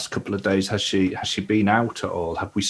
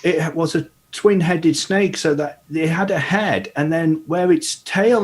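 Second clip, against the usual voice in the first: put-on higher, curious boy's voice; young lad voice; 8 semitones higher than usual. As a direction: ask it like a wide-eyed lad. [name] explains his mistake.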